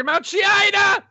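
high, loud mock outburst; mock shout. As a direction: bellow it like a ranting pundit. Mocking a hot-button topic.

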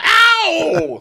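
loud, very high "ow"; hammed-up cry of pain; mock pained yelp. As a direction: yelp as if hit mid-sentence. Acts being hit by ball.

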